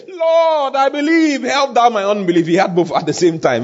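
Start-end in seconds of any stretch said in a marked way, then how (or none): 0.1-2.5 high, drawn-out plea; acted anguished, high-pitched appeal; tearful crying out. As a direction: cry out to God as a desperate father. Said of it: "cried out and said with tears".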